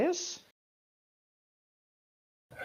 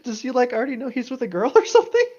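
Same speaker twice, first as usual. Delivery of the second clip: excited disbelief; 7 semitones higher, 8 dB louder, faster than usual. Real, not acted. Surprised question about plot.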